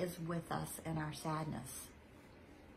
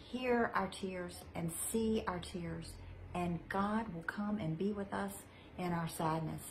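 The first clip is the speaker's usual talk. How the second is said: ordinary talk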